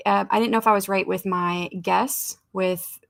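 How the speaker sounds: ordinary talk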